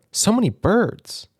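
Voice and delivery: high, slow amazed voice; awed wonder. Acted. Jack gazing around the aviary, slow and amazed. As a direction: marvel at all the birds.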